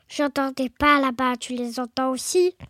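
deep, hushed monster voice; voix du Gruffalo. Acted. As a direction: ask it low as the big beast. Gruffalo speaks in the story. (monstre à voix grave, intrigué)

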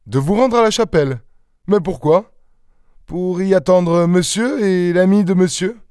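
high, lightly voiced exchange; un dialogue légèrement joué, voix haute; surprised questioning. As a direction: press the chauffeur for answers. Devanne and chauffeur dialogue.

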